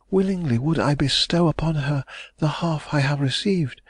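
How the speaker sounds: hopeful heiress pressing for news; young lady, anxious. A narrator's reading.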